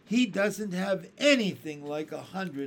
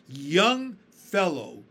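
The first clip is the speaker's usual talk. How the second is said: slow and scornful, drawn out, higher and louder; scornful old grasshopper; 5 semitones higher, 10 dB louder, much slower than usual. A narrator's reading. Old grasshopper scolds the centipede, slow and scornful. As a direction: look down your nose at the young one. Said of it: "speaking in a deep, slow, scornful voice".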